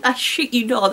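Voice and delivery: ordinary delivery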